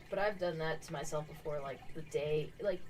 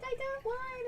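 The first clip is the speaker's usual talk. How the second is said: excited; slower than usual. Retold or imitated. Excited quote of herself.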